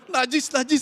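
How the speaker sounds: high, loud comic revulsion; re-enacted appalled outburst; disgusted rejection. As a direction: ward it off in mock horror. Rejects the dreamer in retold story.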